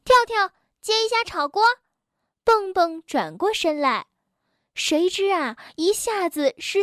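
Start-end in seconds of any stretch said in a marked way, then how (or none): 0.0-1.6 high, quick call to a friend. A narrator's reading. Bengbeng asks Tiaotiao to catch the pan, calling out. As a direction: toss out a hurried request.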